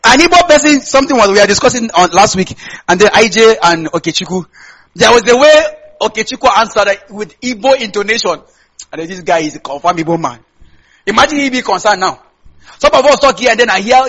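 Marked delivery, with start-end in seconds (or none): none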